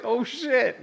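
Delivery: high, loud laughing dismay; patient hearing the doctor used YouTube; dismayed shock. Retold or imitated. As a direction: realize the horror with a laugh. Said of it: "I was like".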